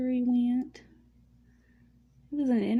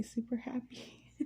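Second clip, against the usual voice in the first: whisper; 9 dB quieter than usual. Real, not acted. Happy aside, voice drops out.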